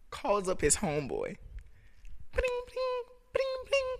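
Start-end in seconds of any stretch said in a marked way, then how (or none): 2.3-4.0 phone ring imitation.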